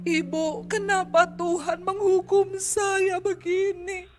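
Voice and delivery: high, slow tearful pleading; imitating a crying woman. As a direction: mimic her weeping complaint. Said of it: "Dia telepon saya sambil nangis". (meniru perempuan menangis)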